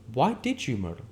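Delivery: high, pressing questions; demanding. Acted. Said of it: "demanded Catherine".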